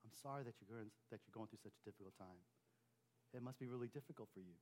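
gentle sympathy. Shown as a demonstration. Pastor modelling how to comfort someone who shares a trial, softly. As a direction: say it softly, acknowledging their pain. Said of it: "it's good to acknowledge that".

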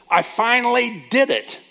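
imitating an excited woman, high and loud; slow, high, triumphant retelling; excited announcement. Counselee proudly telling her counselor her news, excited.

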